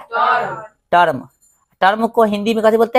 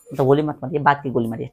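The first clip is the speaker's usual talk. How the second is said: quiet joking aside; 7 semitones lower, 6 dB quieter than usual. Real, not acted. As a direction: lower and softer, a joking correction. Teacher's joke softened aside. (धीमी आवाज़ में मज़ाक)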